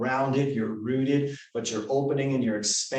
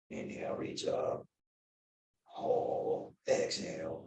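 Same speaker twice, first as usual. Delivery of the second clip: whispered breathing cues; whispering; 4 semitones higher, 9 dB quieter, slower than usual. Shown as a demonstration. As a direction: whisper each cue softly. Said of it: "leading breath practice".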